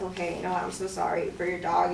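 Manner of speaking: low, soft retold apology; subdued apology. Speaker apologizing to the dog's owner, soft and subdued. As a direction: say sorry gently, voice dropped right down. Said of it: "I just apologized to her".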